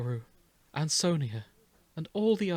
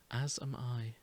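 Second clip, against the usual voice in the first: swallowing tears; 3 semitones lower, 9 dB quieter than usual. Acted. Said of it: "swallowing her tears".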